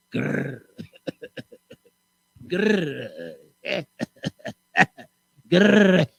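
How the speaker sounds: playful growls broken by laughs; lion growl. Acted. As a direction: growl like a tired old lion, giggling. Old sick lion growling.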